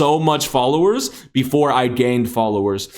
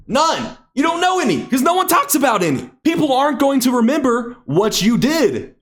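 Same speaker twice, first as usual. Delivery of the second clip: excited insistence; 10 semitones higher than usual. Real, not acted. Heated point to class.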